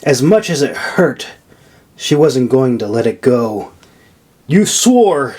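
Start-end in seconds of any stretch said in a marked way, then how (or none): 4.5-5.3 insisting. A narrator's reading. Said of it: "Nora insisted".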